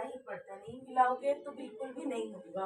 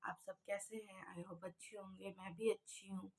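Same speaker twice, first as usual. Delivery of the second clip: soft just-woken whisper; barely voiced morning greeting; sleepy whisper; 4 semitones lower, 12 dB quieter than usual. Real, not acted. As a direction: whisper it, still half asleep. Just woke up, greeting viewers.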